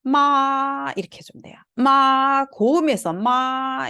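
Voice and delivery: 마 held high and level; 마 high and level, Mandarin first tone. Shown as a demonstration. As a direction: sing 마 on a flat high note. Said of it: "어떻게 읽어야 되냐".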